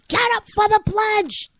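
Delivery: high, full-voiced scream at a student; shrill-pitched, furious shouted command; screamed order. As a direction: scream it like an outraged school boss. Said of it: "she screamed".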